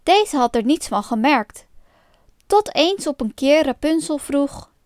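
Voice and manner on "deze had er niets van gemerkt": plain reading voice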